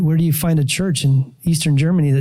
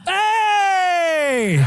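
high, bright mock greeting shout; cheerful shout; 13 semitones higher than usual. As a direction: call out a friendly hey. Passing family greets him.

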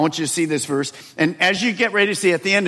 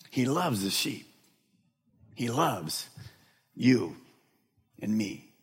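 much softer, lower and tender; 4 semitones lower, 7 dB quieter, slower than usual. Acted. Pastor softly telling the church God loves them, tender. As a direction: ease down gently, almost intimate. Tender close after shouting.

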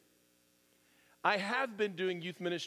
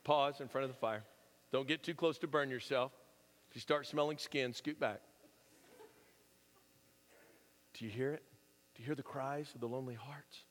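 hushed youth minister; 7 semitones lower, 6 dB quieter than usual. Retold or imitated. Youth minister leading a campfire.

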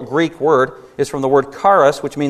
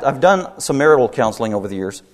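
ordinary delivery

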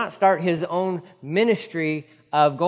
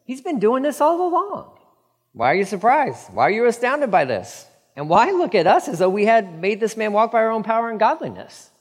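higher, louder, incredulous questioning; exasperated Peter; 6 semitones higher, 3 dB louder than usual. Acted. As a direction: voice Peter pushing back at the crowd. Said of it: "He's like".

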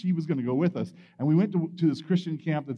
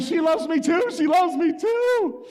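high, loud, slowing shout; sing-song it to the whole world; a high shout of pure joy, drawn out at the end; giddy delight; 12 semitones higher, 4 dB louder, slower than usual. Retold or imitated. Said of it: "And I'm like".

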